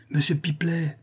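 high, distant-sounding call; calling from upstairs. A narrator's reading. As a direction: summon the porter from upstairs. Voice calls again from upstairs.

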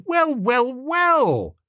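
high-pitched, mounting greeting; delighted cry. A narrator's reading. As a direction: draw out the last word up high, then drop. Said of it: "I cried".

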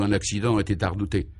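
ordinary delivery

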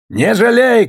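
loud, high sergeant's command; shouted order. A narrator's reading. Sergeant urging his soldier on, loud high shout. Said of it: "кричал ему унтер".

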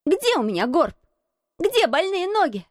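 put-on high, challenging boy; boy's high, defiant questions; cried out. A narrator's reading. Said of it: "крикнул он Бену Уизерстаффу".

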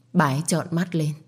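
ordinary delivery, nothing marked

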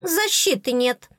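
high, slow little fairy voice. Acted. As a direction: announce it as a tiny fairy. Прокомментировала Оскалка.

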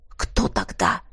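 hoarse unvoiced whisper. A narrator's reading. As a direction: breathe it out in despair. Inner anguished thought.

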